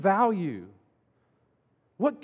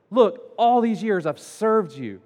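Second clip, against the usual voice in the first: slow, louder protest from a wronged son; hurt; 4 semitones higher, 6 dB louder, slower than usual. Retold or imitated. Older son protesting to his father, hurt and indignant. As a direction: spell out the grievance, acted hurt. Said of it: "the son is so hurt".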